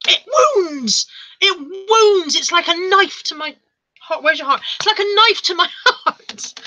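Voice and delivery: high, hammed-up wounded wail cracking into a laugh; mock agony. Streamer telling disloyal chat of the betrayal, mock agony. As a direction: play mortally wounded, big swooping pitch. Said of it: "I don't think you understand. Oh, Lex understands the agony".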